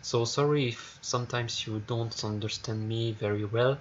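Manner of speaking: ordinary talk, nothing marked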